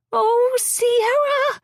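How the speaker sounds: high, drawn-out excited shriek; shrieking with joy. A narrator's reading. Friend bursting in on the patient, shrieking with joy. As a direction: cry her name in wild delight. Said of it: "she shrieks".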